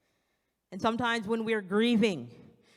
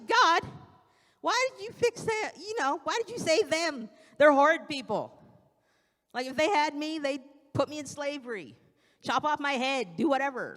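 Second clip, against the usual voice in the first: angry Jonah; 6 semitones higher than usual. Retold or imitated. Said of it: "Jonah, he gets mad about it".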